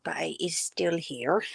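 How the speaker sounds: whispered so hubby won't hear. Real, not acted. Telling the viewer hubby is still about, whispering. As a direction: breathe it out unvoiced, secretive.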